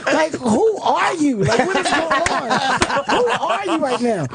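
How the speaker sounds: high-pitched, appalled disbelief; exasperated astonishment, retold with laughter; incredulous. Group confronts friend biting off fingerprints.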